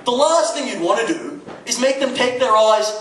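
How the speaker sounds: ordinary delivery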